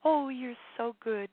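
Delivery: a touch of a happy inner cheerleader. Acted. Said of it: "hear that happy voice say".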